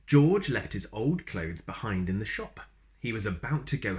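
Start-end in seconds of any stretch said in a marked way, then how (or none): none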